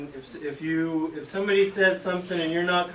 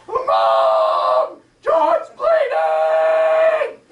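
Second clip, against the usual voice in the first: yelling for mom; 8 dB louder, much slower than usual. Retold or imitated. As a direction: yell for mom across the field, slowly. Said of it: "and she says".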